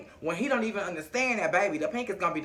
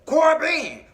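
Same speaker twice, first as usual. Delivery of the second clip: calling out; 8 semitones higher, 7 dB louder than usual. Retold or imitated. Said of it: "he was like".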